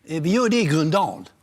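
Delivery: a producer pitching a cheap location, excited; excited phone plan. Retold or imitated. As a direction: sell the plan with a grin. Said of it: "Så ringde han".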